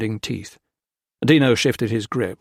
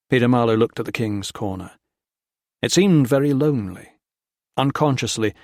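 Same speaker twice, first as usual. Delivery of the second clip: usual speaking voice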